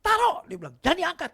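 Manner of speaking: high, loud commands; boss ordering. Retold or imitated. Boss ordering the maid to put the phone down, loud and high. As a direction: snap out the order.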